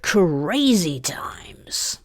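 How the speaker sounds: very slow, stretched "Crazy times"; drawn-out catchphrase. Pretend. Host musing over crazy times, very slow drawn-out delivery. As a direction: stretch "Crazy" right out after a sigh. Catchphrase repeated after a sigh.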